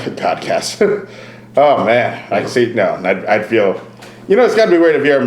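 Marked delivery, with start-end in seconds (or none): 0.0-1.1 repeating Godcast through a laugh. Real, not acted. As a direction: say Godcast slowly while laughing.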